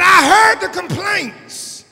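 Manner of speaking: high slow shout of "I heard the complaints"; shouted God voice. Acted. Preacher as God shouting at the grumbling Israelites. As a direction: cry it out as the Lord.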